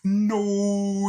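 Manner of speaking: high, loud mock monster cry; a hammed-up loud defeated-monster wail; monster's anguished no. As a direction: howl it like a beaten monster.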